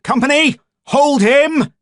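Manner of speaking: very high, loud barked order; angry command shout; growled command. A narrator's reading. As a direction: roar at the troops. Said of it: "the boffin growled".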